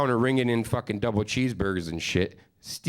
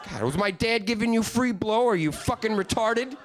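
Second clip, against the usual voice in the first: mock rant; 12 semitones higher than usual. Comedian ranting at his date's dumb friend, high mock rant. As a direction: tear into her, pitched high.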